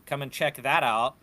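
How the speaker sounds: ordinary talk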